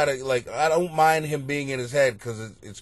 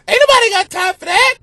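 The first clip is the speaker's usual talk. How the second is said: high, loud imitation of the interview lady; viral lady impression; 10 dB louder, faster than usual. Comic quoting the viral interview lady, high and loud. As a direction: squeal it like the famous viral lady. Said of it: "That, that lady".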